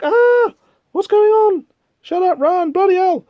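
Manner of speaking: high-pitched mock panic; panicked. Homeless people fleeing the monster, mock panic.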